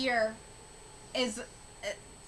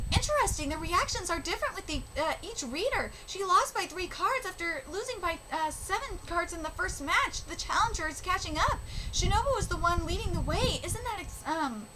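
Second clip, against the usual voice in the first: higher, earnest reading voice for the characters; reading manga aloud; 6 semitones higher than usual. Acted. Vlogger reading karuta manga dialogue to viewers, earnest and higher. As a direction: read each speech bubble with feeling.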